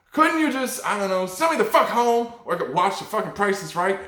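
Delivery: high-pitched mock indignant plea; exasperated student protest. Acted student pleading against suspension.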